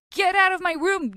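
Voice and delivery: high defensive yell; defensive shout. Acted. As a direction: yell him out of the room. Mark shoves him away.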